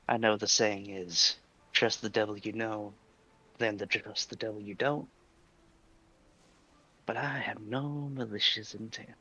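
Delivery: lightly voiced Dizzy character; a hint of the Dizzy character voice. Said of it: "You're still doing the Dizzy voice".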